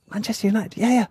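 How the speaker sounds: excited fan. Retold or imitated. Bri's excited football chatter.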